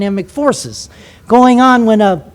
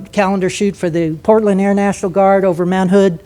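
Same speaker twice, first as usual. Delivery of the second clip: ordinary talk, nothing marked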